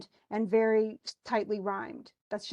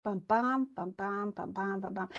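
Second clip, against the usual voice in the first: chanted "bum, bum" in weak-strong pairs, fading softer; sounded-out iambic beat; 4 dB quieter than usual. Shown as a demonstration. Teacher sounding out a poem's rhythm on "bum", in even iambic pulses. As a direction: tap out the meter with the voice on "bum". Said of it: "Do you hear that?".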